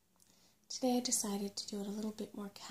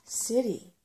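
startled; 3 semitones higher, 8 dB louder than usual. A narrator's reading. Shocked question about the city.

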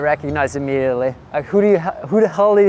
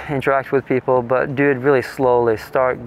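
ordinary talk, nothing marked

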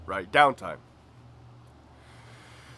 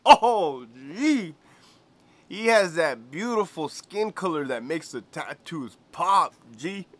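much higher, louder swoon; Greñas voice; 5 semitones higher, 5 dB louder, slower than usual. Retold or imitated. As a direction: gush with a dreamy sigh. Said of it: "He goes".

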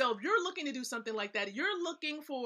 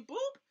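playful boop; 13 semitones higher than usual. Pretend. Playful pop sound before beach.

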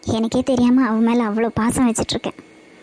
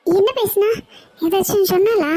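high, urgent questioning; agitated; 6 semitones higher than usual. Acted. Aunt questioning Krish about her missing daughter, agitated. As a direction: press anxiously for news. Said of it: "ஏன் அத்தை அப்படி பதட்டப்படுறீங்க".

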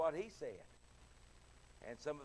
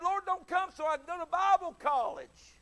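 comic pleading; 12 semitones higher, 10 dB louder than usual. Retold or imitated. Young preacher pleading to God.

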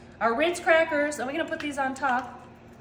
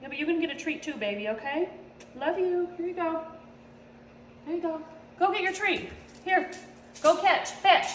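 sweet talk to the dog; 3 semitones higher, 3 dB quieter than usual. Real, not acted. Talking sweetly to her dog.

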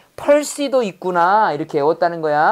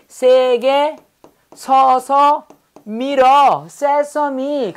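higher, slow, pushing hard; pun demo; 5 semitones higher, much slower than usual. Shown as a demonstration. Teacher acting out the sesame pun, shoving hard. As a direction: stretch it out like shoving a heavy door. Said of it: "세서미야".

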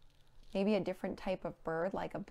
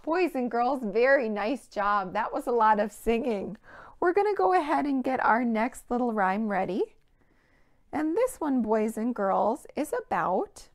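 loud, bright praise for the class; cheerful praise; 2 semitones higher, 8 dB louder than usual. Real, not acted. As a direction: enthusiastic teacher praise.

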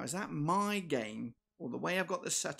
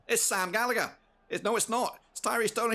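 high, loud exasperated yell; frustrated shout; 6 dB louder than usual. Real, not acted. Frustrated at slow player.

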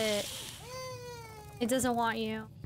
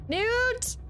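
high-pitched call of the name; 8 semitones higher than usual. Real, not acted. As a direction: call out to Newt in protest. Calling out to Newt in game.

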